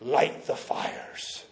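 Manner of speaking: slow, hushed gravelly movie voice; deep, gravelly voice. Acted. Somebody in a fantasy movie orders the signal fires lit, deep gravelly voice. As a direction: rasp it slowly and low, like an epic film herald. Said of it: "in a really deep, gravelly voice".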